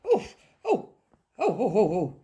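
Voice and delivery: breathy woofs, then a quick run of barks; dog waking with barks. Acted. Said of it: "Dog woke up with a".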